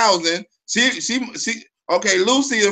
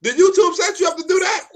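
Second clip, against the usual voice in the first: high-pitched disbelieving question; shocked, voice pitched way up; incredulous accusation; 10 semitones higher than usual. Real, not acted.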